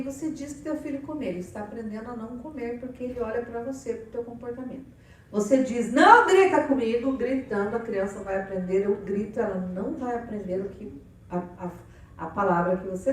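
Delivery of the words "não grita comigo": loud, higher shout "Não grita comigo"; parent yelling. Retold or imitated. Parent yells at the child not to yell.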